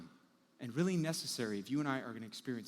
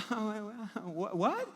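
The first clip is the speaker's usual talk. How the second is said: fake innocent surprise; 7 semitones higher, much slower than usual. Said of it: "And she's like".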